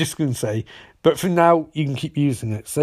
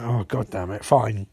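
grudging give-in; 6 semitones lower, 4 dB quieter than usual. Retold or imitated. Cisco reluctantly giving in.

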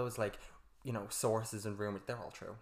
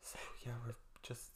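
whisper; 11 dB quieter than usual. Pretend. Mock whisper after monotone joke.

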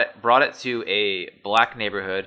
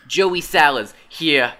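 slow New Jersey intro, voice held higher; New Jersey accent; 4 semitones higher, 5 dB louder, slower than usual. Retold or imitated. Podcaster retrying a New Jersey YouTuber intro, slow. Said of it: "There, there, that's better".

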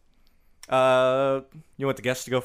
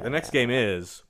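normal talking voice

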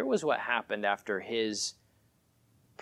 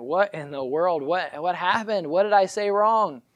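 higher and louder, rising in disbelief; bewildered dismay; 8 semitones higher, 9 dB louder than usual. Retold or imitated. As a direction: act crushed confusion, building. Bewildered inner thought.

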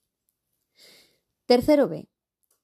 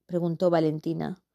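usual speaking voice

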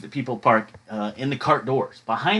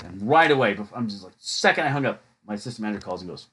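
usual speaking voice

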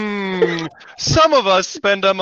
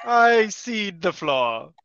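drawn-out pompous delivery, x0.5; slow formal; much slower than usual. Pretend. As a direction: say it slowly like a stuffy chairman. Mock formal meeting.

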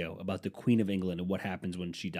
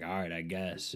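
mumbling reluctant; 4 semitones lower, 7 dB quieter than usual. Retold or imitated. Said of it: "he's like".

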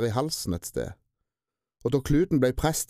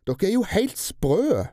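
high, loud, slow outburst; angry yell; 9 semitones higher, 3 dB louder, slower than usual. A narrator's reading. Albert yelling angrily at the kidnappers. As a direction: rage at them.

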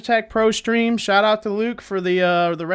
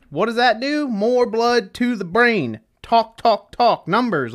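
usual speaking voice

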